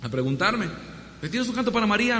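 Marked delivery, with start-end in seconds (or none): 1.3-2.2 higher, friendly request for a song; polite asking. Retold or imitated. Organizer politely asking for a Marian song, friendly. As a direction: ask nicely, hopeful lift. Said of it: "más Gestapo".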